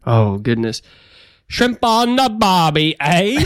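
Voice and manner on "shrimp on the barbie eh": high, drawn-out mock Australian accent. Shown as a demonstration. Goaded guest attempts a hammed-up Australian accent. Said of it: "you can do an Australian accent".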